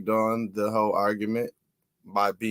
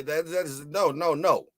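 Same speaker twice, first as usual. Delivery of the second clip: ordinary talk, nothing marked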